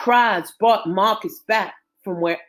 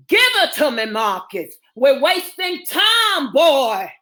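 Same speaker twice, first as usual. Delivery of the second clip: urgent order; 5 semitones higher, 5 dB louder than usual. Acted. Doctor demanding the baby from Marcus, urgent. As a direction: order them sharply, stretching the key words.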